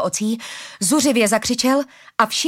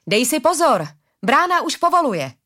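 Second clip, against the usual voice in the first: higher, urgent warning call; shouted warning; 6 semitones higher, 4 dB louder than usual. A narrator's reading. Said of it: "křikla na hlídače Arila".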